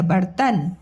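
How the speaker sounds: ordinary delivery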